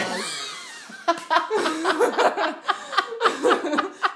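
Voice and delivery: gargalhada aguda e alta. Real, not acted. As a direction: explode numa gargalhada. (gargalhada alta)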